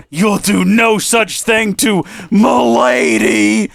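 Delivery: high, slow, shouted gallant vow; hammed-up heroic knight. Hero defending his lady from the alien, slow shouted vow. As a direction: play the chivalrous hero to the hilt.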